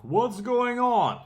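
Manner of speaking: loud, high, slow demand; blurting out what's going on at full volume; exasperated shout. Retold or imitated. Shipper on the phone, fed up with delays, shouting.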